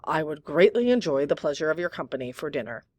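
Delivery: ordinary delivery, nothing marked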